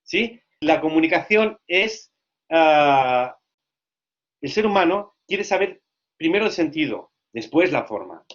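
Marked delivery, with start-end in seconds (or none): none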